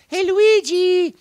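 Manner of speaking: very high, loud, slow call; Mario calling Luigi. Retold or imitated. Mario calls his brother Luigi from the TV set, high and loud. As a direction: call across the house for your brother. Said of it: "So I'd have to go".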